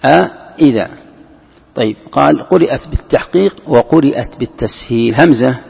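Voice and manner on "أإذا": أإذا split into a- and -idha; two hamzas separated. Shown as a demonstration. Teacher showing question hamza and original hamza, أإذا split apart. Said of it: "همزة إذا الأصلية".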